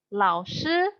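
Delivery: ordinary talk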